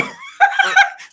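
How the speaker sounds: high burst of laughter. Real, not acted. Laughing at her own joke.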